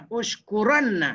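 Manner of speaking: "Ushkuranna" slow, with a long double n; correct form, ushkuranna. Shown as a demonstration. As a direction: stretch the ran and nn in "Ushkuranna". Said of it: "Bukan ushkurna". (sebut "Ushkuranna" dengan nun tebal)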